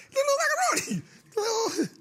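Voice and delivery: high cracked laughing mockery; laughing shriek. Real, not acted. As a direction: cry it out through laughter. Mimicking friend, laughing shriek.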